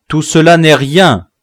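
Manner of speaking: confident pronouncement. A narrator's reading. Sorcerer to the wounded chief, loud and confident. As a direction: dismiss the wound boldly.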